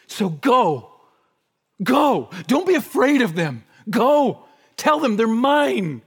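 loud, high preacher's exhortation; rousing charge. Real, not acted. Preacher commissioning the church to go without fear, rousing. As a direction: send them out with ringing urgency. Urges church outward in Christ's words.

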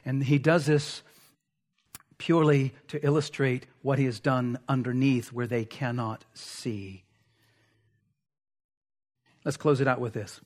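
normal talking voice

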